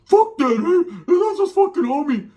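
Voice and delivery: high, loud indignant homie voice; imitating a worked-up friend; retold outrage. Retold primo's outraged reaction.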